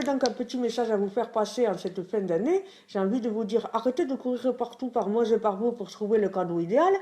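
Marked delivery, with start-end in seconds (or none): none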